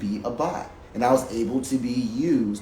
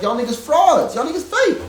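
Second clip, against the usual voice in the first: much higher and louder, an angry accusing yell; furious outburst; 12 semitones higher, 6 dB louder than usual. Retold or imitated. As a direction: shout them down as fakes. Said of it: "And I was mad".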